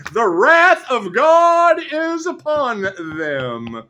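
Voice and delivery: high, loud, slow declaiming; booming proclamation. Acted. Reader declaring God's wrath on them, loud slow proclamation. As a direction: proclaim doom, stretching every word.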